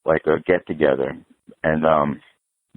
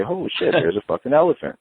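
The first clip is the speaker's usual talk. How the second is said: mock surprise; 8 semitones higher than usual. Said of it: "I'm gonna say".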